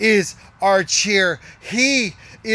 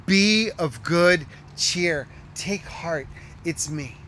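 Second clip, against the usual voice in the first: soft tender voice of Jesus; 4 semitones lower, 5 dB quieter, slower than usual. Pretend. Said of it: "when he will whisper".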